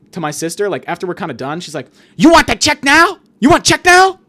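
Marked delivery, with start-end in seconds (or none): 2.2-3.2 high, loud shouted question; imitated waitress screaming in someone's face. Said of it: "Screaming in her face". 3.4-4.2 high, loud shouted question; imitated waitress screaming in someone's face. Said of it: "Screaming in her face".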